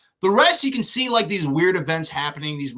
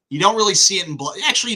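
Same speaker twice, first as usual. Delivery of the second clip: normal talking voice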